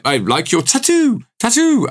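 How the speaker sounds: "tattoo" with both t's sounded, jumping high; high, exaggerated double-t "tattoo"; owl-like tattoo. Shown as a demonstration. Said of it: "I sound like an owl".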